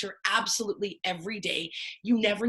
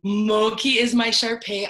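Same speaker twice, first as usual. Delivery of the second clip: normal talking voice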